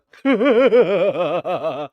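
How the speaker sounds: loud, high unhinged cackle; unhinged laugh. Shown as a demonstration. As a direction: cackle like a madman. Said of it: "I gotta laugh, like, fucked up?".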